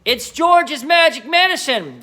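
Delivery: high, loud, slow shout from the rooftop; Grandma shouting. Acted. Grandma shouting down from the roof to Mary, loud and slow. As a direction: bellow it down, thrilled. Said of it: "Grandma shouted".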